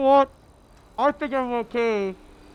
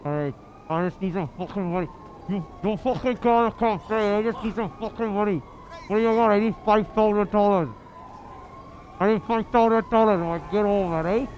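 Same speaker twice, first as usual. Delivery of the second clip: put-on menacing Welsh accent demanding money; aggressive Welsh accent. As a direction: threaten in a hard Welsh accent. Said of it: "aggressive Welsh".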